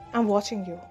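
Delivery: ordinary talk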